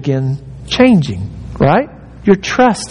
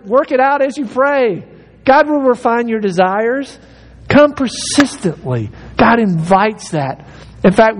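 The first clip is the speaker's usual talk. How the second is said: ordinary delivery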